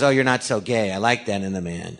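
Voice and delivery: low impression, sinking at the end; Kathleen Turner. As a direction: lightly imitate a seductive film star. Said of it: "So I did Kathleen Turner".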